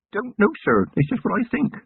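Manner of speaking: keen country lad proud of his notebook; eager young constable. Acted. Young constable telling his commander, earnest lad voice.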